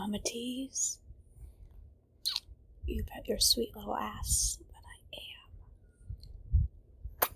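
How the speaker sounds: soft sleepy whisper; pillow talk. Acted. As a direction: whisper goodnight close to her ear. Goodnight whispers to partner.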